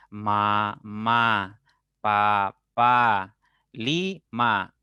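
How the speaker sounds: loud, syllable by syllable; first-grade reading drill. Retold or imitated. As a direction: mimic kids sounding out syllables. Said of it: "la profesora les hacía leer".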